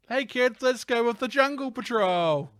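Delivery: very high, chirpy host; kids' TV presenter. Pretend. TV host inviting kids along, high mock cheer. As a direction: pitch it way up for little viewers. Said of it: "Sounds like a kid's, like, like, T V show".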